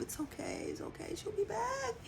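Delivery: rock yourself gently with the words; soft self-comfort. Retold or imitated. Reassuring herself that partner will return, soft and quiet. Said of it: "comforting myself".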